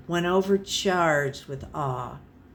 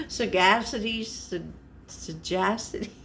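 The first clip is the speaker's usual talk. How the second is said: stumbling over word; 5 dB quieter than usual. Real, not acted.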